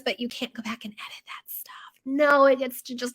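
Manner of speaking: conspiratorial whisper to the viewers. Acted. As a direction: let them in on a secret, hushed. Confiding the social media lesson.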